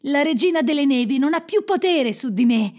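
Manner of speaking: high, triumphant declaration; elated boy's exclamation; jubilant cry. A narrator's reading. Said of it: "gridò Kai".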